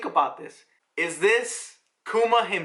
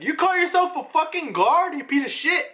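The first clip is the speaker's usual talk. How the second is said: higher, yelled insult; mock furious yell; 9 semitones higher than usual. Noble screaming at a guard again, higher mock yell. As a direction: bark the insult like an enraged noble. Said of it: "remember how the first guy was like".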